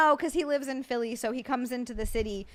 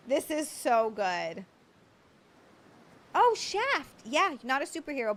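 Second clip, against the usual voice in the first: ordinary talk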